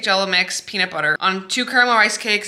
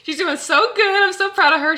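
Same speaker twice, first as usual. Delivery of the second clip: higher, louder, gushing praise for a client; higher, louder proud gushing about Reagan; 9 semitones higher, 6 dB louder than usual. Real, not acted. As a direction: beam with pride as you say it.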